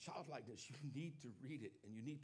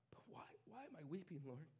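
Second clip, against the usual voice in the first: softer, quick breathless whisper; tearful whisper; 3 semitones higher, 6 dB quieter than usual. Retold or imitated. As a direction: whisper the question to the Lord through tears. Weeping while reading the book.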